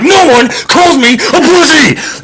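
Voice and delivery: high, slow, laughing yell; hammed-up outraged hero yelling; mock rage shout. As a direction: roar it out, cracking up at yourself. Odysseus enraged at beggar.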